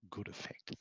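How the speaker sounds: near-silent breathy whisper, almost no voice; failed whisper demo. Shown as a demonstration. Lecturer demoing a voice too quiet to students, whispered. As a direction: drop it to a barely audible whisper, overdone.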